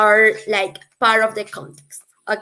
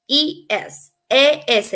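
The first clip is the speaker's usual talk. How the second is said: normal talking voice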